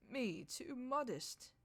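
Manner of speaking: indignant outburst; bursting out. Acted. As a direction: throw the words back in disbelief. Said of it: "Tegens burst out".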